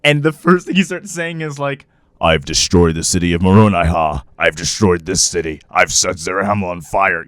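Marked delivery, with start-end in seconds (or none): 2.2-7.2 very low, booming announcer; deep monotone pronouncement, far below own pitch; commercial announcer voice. Acted. Host voicing Christ announcing destroyed cities, deep commercial voice. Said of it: "why does he have the commercial voice".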